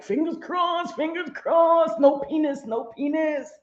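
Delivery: high, pleading chant; hopeful pleading. Retold or imitated. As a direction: beg the universe, hands clasped. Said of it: "I'm like".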